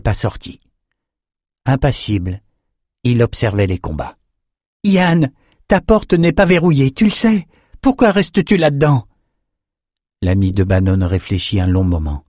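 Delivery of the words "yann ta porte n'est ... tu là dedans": high-pitched, insistent appeal; urgent questioning. Acted. Young fencer at his friend's open cell, urgent. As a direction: press a friend for an answer. Friend urges prisoner to come out.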